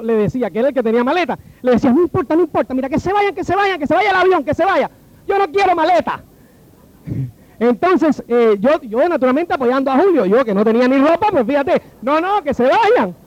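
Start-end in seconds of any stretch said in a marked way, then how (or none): 1.9-6.2 keen to drop the suitcase; eager insisting. Retold or imitated. Julio insisting plane may go. 12.0-13.1 higher and slow, pleading; eager insisting. Retold or imitated. Backing Julio, let them go.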